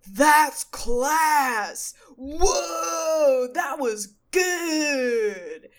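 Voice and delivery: hammed-up imitation of an excited yell; high, straining holler, last word stretched long; throat-straining shout. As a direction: shout it till the throat hurts, mimicking him. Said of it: "hurt my throat to do that".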